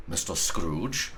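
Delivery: put-on a stiff old gentleman, unsure of him; hesitant wary query; portly gentleman, wary. A narrator's reading. Gentleman's surprised question.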